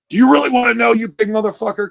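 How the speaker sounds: high, loud confrontational challenge; pissed-off mom. Acted. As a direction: act the mother squaring up, fed up. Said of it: "she's getting a little pissed".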